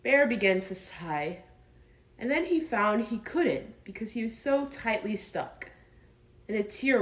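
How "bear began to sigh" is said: plain reading voice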